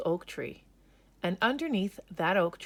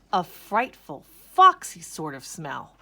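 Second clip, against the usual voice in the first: much louder, slow ominous narration; louder, drawn-out suspense; spooky emphasis; 9 dB louder than usual. A narrator's reading. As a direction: make the smell sound frightening.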